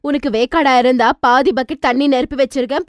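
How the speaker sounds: ordinary delivery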